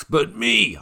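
high, loud self-satisfied quip; smug boast. Real, not acted. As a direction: brag about yourself, playfully. Boasts he drafted him.

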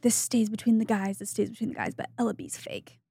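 imitating a man confiding on the quiet. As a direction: drop your voice as if letting the guys in on a secret. Retelling a secret told to guys.

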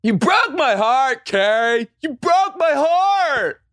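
high, slow, wounded line read; mock heartbroken Aiden. Hammed-up imitation of Aiden's line for her cohost, high and slow. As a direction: drag out the heartbreak.

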